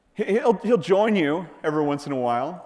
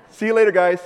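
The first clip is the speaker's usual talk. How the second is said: breezy farewell with a laugh, higher and louder; laughing goodbye; 7 semitones higher, 7 dB louder, faster than usual. Pretend. Preacher laughing as he mimics Jesus's breezy goodbye. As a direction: chuckle through a casual see-ya.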